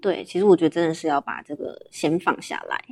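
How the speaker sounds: ordinary delivery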